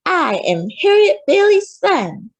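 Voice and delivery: put-on a little boy announcing himself proudly; proud child. A narrator's reading. Frederick boasting to his mama, high proud child voice.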